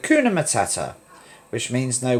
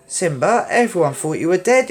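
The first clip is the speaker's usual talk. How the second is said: exclaimed; 6 semitones higher, 3 dB louder than usual. Acted. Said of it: "exclaimed Nala".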